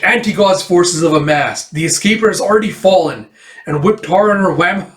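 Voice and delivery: urgent battle report. Acted. Starlock reports battle emergency.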